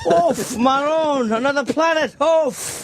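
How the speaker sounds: high mock Italian accent; jolly italian pizza chef. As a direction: groan it like a comic Italian. Continues Italian astronomer bit.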